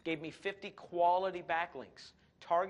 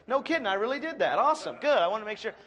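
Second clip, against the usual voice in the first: mock excitement; 6 semitones higher, 8 dB louder than usual. Mock reply to Google alert.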